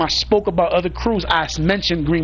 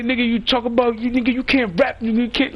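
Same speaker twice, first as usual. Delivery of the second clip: imitated rival mockery; 6 semitones higher than usual. Rivals mock his rapping.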